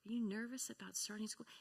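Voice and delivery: a parent gently asking about nervous feelings; gentle curious parent. Retold or imitated. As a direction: softly draw out the worry. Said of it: "But instead it's like".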